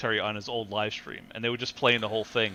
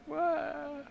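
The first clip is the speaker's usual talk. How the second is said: higher, a squeaky startled "What?"; mock startled streamer; 6 dB quieter than usual. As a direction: jump in shocked, pitched way up. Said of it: "be like".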